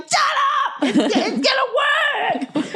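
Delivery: high, strained mock yell, slowing on the last words; hammed-up snapping at the kid over the tent; frustrated shout. Mum snapping at her son over the tent, a high hammed-up shout. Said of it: "And I'm like".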